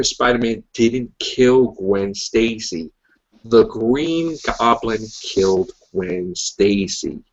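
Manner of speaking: very slow, deliberate delivery with pauses; slow, emphatic explanation. Real, not acted. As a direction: spell it out word by word. Laying down comic-book facts emphatically.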